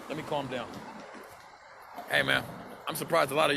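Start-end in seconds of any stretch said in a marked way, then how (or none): 0.1-0.7 much softer, settling down; soft, cooled-off murmur; calming down. Real, not acted. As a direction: talk yourself down quietly.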